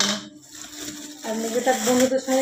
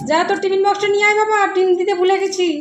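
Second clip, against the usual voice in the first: loud, high call to her son across the house; 9 semitones higher, 9 dB louder than usual. Real, not acted. Calling son to bring tiffin box.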